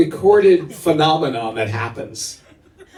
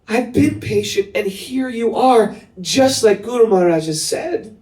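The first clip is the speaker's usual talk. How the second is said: much higher, a hint of tears, slowed on key words; tearful devotion; 9 semitones higher than usual. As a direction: pour out years of waiting. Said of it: "with tears in her eyes".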